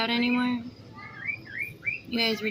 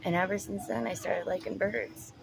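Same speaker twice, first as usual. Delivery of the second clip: usual speaking voice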